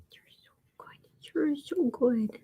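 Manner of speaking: hushed tender whisper. Real, not acted. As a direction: whisper praise to the cat. Whispering to the cat.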